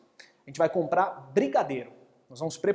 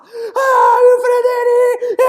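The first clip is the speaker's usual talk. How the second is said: high, loud, slow crying wail; crying child; 14 dB louder, much slower than usual. Acted. Boy crying to his father about the turtle. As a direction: wail the name through tears. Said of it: "chorando, fala".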